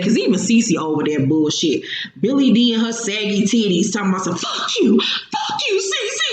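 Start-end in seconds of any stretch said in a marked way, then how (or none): 4.4-6.2 dramatic. Retold or imitated. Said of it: "very dramatic".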